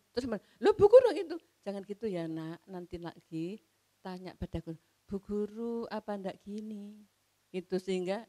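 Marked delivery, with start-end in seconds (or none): 0.6-1.4 put-on child protesting. Child protests teacher's mistake. 1.6-7.1 softly reassuring mother; gentle correction. Retold or imitated. Mother softly teaching her child to ask politely.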